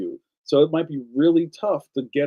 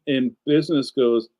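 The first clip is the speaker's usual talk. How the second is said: ordinary talk, nothing marked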